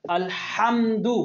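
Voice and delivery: slow "अल्हम्दु" with friction on the h; throaty ha. Shown as a demonstration. Teacher models the word for the class, throaty ha. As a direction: draw out "अल्हम्दु", squeezing the h in mid-throat. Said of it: "हा को यहाँ से तवज्जो के साथ".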